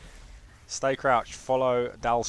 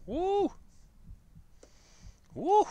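high-pitched "woo!"; excited high woo; whoop; 3 dB louder than usual. Real, not acted. Excited cheer at stream start.

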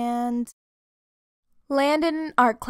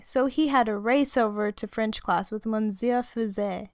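ordinary delivery, nothing marked

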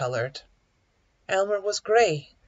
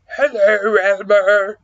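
loud, drawn-out crocodile greeting; storybook crocodile calling out; 3 semitones higher, 11 dB louder, slower than usual. A narrator's reading. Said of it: "Hello, Crocodile".

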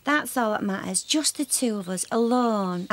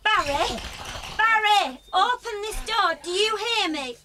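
high furious demand at the door; angry shouting; 6 semitones higher than usual. Acted. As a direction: shout through the locked door. Wife demanding husband open door.

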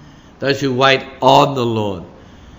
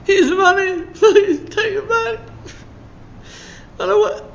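high, desperate begging voice, much higher; pleading Judas. Acted. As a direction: plead with the priest to take it back. Judas pleads with the priest again.

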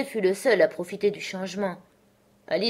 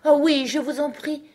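high, louder plea; eager pleading; 9 semitones higher, 6 dB louder than usual. A narrator's reading. Alice begging the Loir for a story, eagerly pleading. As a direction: beg for it keenly.

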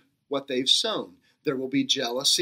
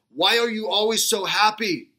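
put-on higher, heavy Georgian-style accent, a bit Schwarzenegger-like; thick Georgian accent; 5 semitones higher than usual. Georgian professor asks the shoeshine man, in a thick Georgian accent. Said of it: "in his thick accent".

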